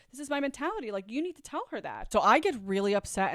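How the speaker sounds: usual speaking voice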